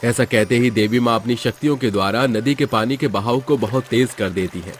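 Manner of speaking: ordinary delivery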